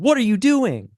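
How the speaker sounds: high, loud, alarmed ask; exclaimed question. Shown as a demonstration. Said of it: "record my own voice going".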